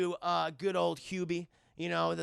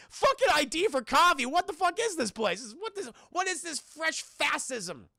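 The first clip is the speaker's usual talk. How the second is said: high-pitched incredulous outrage; indignant; 7 semitones higher than usual. Retold or imitated. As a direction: protest it as absurd, pitched well up. His indignant reaction to ID check.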